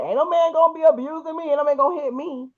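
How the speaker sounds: high, firm defiant declaration; a hint of defiant attitude. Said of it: "I'm one of those that's like".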